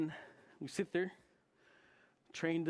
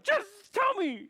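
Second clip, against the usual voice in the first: very high, loud and slow; desperate demand; 11 dB louder, much slower than usual. Retold or imitated. Pressing Ben to confess, high and loud. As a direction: drag the words out in a high wail. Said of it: "I'm like".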